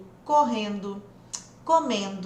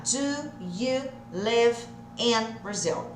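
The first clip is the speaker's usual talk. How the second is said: slow, every word of "Do you live in Brazil?" said separately; much slower than usual. Shown as a demonstration. Teacher separating the words for students, slow and deliberate. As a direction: pull the words apart and say them slowly. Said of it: "Separadinho vai ficar".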